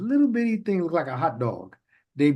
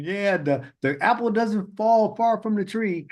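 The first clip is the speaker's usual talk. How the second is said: higher voice, tossing off the proverb; higher, jokey folksy proverb; mock folksy saying; 8 semitones higher than usual. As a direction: say it like a smug know-it-all. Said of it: "throw out an idiomatic expression like".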